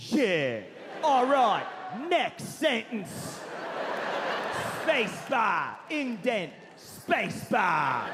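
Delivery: drawn-out British rock-star dictation; exaggerated star's drawl, each command on its own. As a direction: dictate in imperious, stretched bursts. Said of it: "Just one word at a time".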